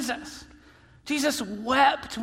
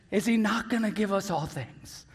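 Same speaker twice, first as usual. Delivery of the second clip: ordinary delivery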